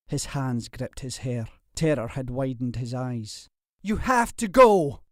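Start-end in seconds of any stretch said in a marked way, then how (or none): 3.8-5.0 a higher, louder repeat; louder urging. A narrator's reading. Terrified suitor urging her to flee, louder. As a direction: push the warning harder. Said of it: "he said louder".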